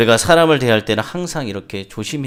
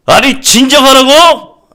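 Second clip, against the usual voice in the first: very high, drawn-out furious yell; angrier shout; 15 dB louder, slower than usual. Acted. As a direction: explode at being told to calm down. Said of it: "더욱 화를 내면서 말하기 시작합니다". (더욱 화나서 길게 내지르는 고함)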